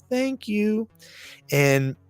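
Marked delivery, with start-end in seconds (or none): 0.1-0.9 put-on high, innocent child; a small child's grateful, high-pitched reply; cutest little kid's voice. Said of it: "in the cutest little kid's voice".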